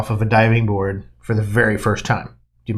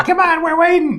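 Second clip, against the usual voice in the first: imitated impatient crowd. Impatient onlookers calling to the diver on the platform, high mock yell. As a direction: holler up high, half laughing. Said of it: "all these people going".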